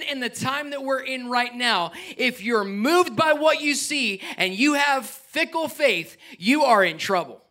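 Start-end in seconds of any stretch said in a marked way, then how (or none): 5.1-6.2 "fickle faith" stretched slow and high; stress on fickle faith. Shown as a demonstration. Preacher warning the church, high and slow. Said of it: "I call it fickle faith".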